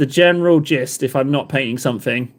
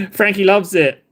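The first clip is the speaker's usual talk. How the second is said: ordinary talk, nothing marked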